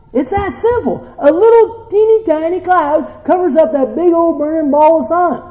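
high-pitched preacher's marvelling; fired-up preaching. Real, not acted. Preacher marvelling at a tiny cloud, fired up. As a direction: ride high through the whole line.

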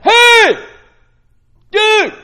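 loud, high hey dude; shouting for attention. Retold or imitated. Calling a friend from across the room, loud shout. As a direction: yell to get someone's attention. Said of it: "No, you're like".

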